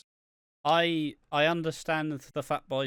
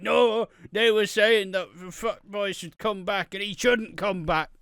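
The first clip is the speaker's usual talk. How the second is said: indignant fan; 8 semitones higher, 8 dB louder than usual. Retold or imitated. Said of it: "Be like".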